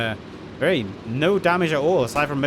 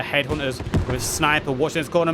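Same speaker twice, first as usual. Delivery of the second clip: normal talking voice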